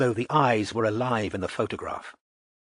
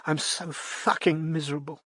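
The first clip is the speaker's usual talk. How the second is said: lightly miserable plea; 6 semitones higher, 3 dB quieter than usual. A narrator's reading. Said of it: "She almost wailed".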